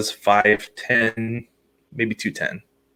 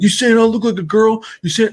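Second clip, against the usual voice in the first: higher, louder mock offended challenge; imitating angry boyfriend; 12 semitones higher, 5 dB louder than usual. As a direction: play the touchy boyfriend, affronted. Angry boyfriend confronts them.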